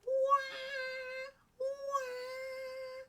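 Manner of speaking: high, sustained monkey screech; screaming monkey wail. A narrator's reading. Monkey attempting a tune for Ellie, high screaming. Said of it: "The monkey screamed".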